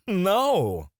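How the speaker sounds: loud, high, amused "no"; mocking refusal. Boss refusing to let an employee go, mocking. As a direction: laugh it off and refuse outright. Laughs off request to leave.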